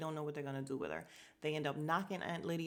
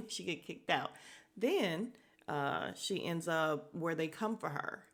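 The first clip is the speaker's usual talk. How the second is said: ordinary talk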